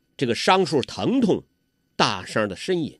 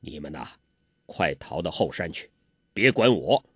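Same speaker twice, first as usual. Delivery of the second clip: a lightly voiced old master, lower and soft; weary old master; 8 semitones lower, 4 dB quieter than usual. A narrator's reading. Wounded old master telling the youngsters to flee, low and soft. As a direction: urge them to flee, low and tired.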